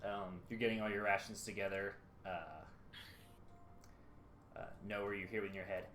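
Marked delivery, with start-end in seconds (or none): none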